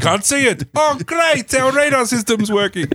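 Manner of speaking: high, laughing mock Russian; hammy Russian accent, giggling through it. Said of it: "first poor Russian accent for the evening".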